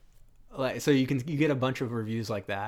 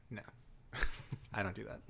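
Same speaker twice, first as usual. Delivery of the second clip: deadpan actor; 10 dB quieter, faster than usual. Retold or imitated. Mocking an actor who won't yell for the script, deadpan. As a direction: refuse quietly with no inflection at all. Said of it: "everything is deadpan".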